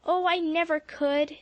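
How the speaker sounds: dismay. A narrator's reading. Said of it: "said Polly in dismay".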